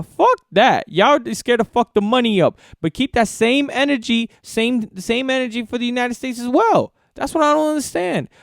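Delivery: high, loud angry outburst; fired-up rant at the league; heated rant. Real, not acted. Ranting about NBA apology.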